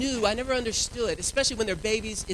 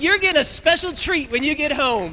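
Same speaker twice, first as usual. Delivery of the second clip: gleeful promise; 8 semitones higher, 10 dB louder than usual. Retold or imitated.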